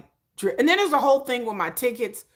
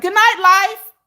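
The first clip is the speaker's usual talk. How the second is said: high, loud, hammed-up goodbye; dramatic farewell; 12 semitones higher, 12 dB louder, slower than usual. Sick mom bidding life goodnight, over-the-top high cry. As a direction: throw it out like a melodramatic exit. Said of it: "And you're like".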